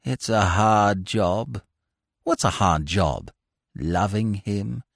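lightly voiced long-suffering suitor; weary lovesick Bingo. A narrator's reading. Lovesick Bingo sighs to Bertie about loving the kid, slowly.

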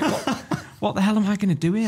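half laugh the panicked question. Retold or imitated. Retelling his panic in the queue with a laugh.

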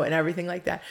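ordinary delivery

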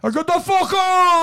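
high, loud furious mock roar; angry giant yell. Retelling the wrestler raging at the camera crew, loud furious yell. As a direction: bellow it like an enraged giant. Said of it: "he got all fucking pissed".

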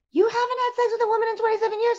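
mock disbelief from the buddy; incredulous roommate. Roommate's disbelief voiced in story.

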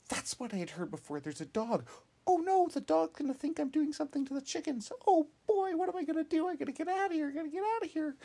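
high, loud panicky voice; a rabbit in a flat panic; scared bunny. A narrator's reading. Bunny realising a dog is coming, panicking high and loud. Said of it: "And she's like".

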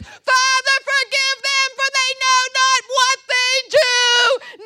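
very high, loud shouted plea; Jesus' cry from the cross. Acted. Jesus on the cross pleads for forgiveness, shouted cry. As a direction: cry it out at the top of the voice. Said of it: "the echo of Jesus' cry on the cross".

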